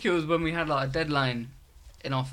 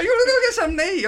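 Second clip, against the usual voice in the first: much higher, faster, laughing put-on voice; mock friend voice; 7 dB louder, faster than usual. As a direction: squeak the invite out fast, laughing.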